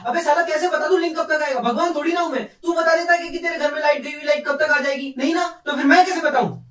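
high-pitched, fed-up outburst; worked-up comic rant, pitched high throughout; exasperated retort. Acted. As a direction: snap back at a nagging customer. Acted retort to nagging customer.

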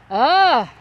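loud delighted ugh; excited groan. Real, not acted. Gasping at plants.

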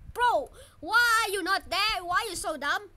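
loud, high scolding questions; exasperated yell. Real, not acted. Player fed up with the game, exasperated yell. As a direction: yell it in frustration.

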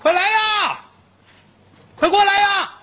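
high, loud call across the yard; shouting for help. A narrator's reading. Prisoner in his cell calling the inmates over, loud high shout. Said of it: "三爷坐屋里喊".

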